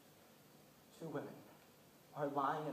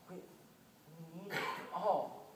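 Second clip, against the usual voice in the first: grave slow admission; 4 dB quieter, much slower than usual. Retold or imitated. Doctor repeats grave truth.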